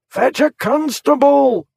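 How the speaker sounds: high, loud desperate shout. A narrator's reading. Warbury shouting for a constable, desperate loud shout. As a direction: shout it to anyone who'll listen. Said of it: "he shouted".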